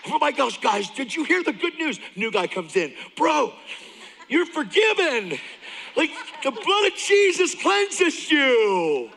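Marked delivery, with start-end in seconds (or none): 0.1-2.0 much higher, eager announcement; freaking out excitedly. Retold or imitated. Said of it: "I started to freak out". 3.2-9.1 much higher and louder, slow and drawn out; ecstatic preaching to new guy. Retold or imitated. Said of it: "I started to freak out".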